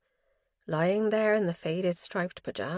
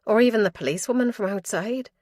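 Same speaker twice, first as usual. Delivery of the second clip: ordinary delivery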